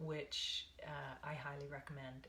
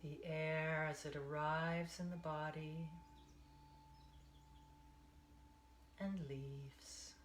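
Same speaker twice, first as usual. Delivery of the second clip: guided meditation. Acted. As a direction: ease them into the breath, one count at a time.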